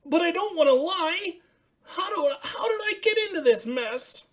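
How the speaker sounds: high, bright puppet voice for Ernie; excited high Ernie calling out. Acted. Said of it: "he said".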